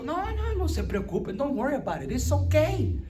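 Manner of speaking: breezy brush-off; dismissive mock reassurance. Preacher mocking those who shrug off sin with a breezy brush-off. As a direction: wave it away lightly, mocking.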